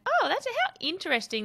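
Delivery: high-pitched retold fascination; intrigued discovery. Host recalling googling voiceovers, intrigued.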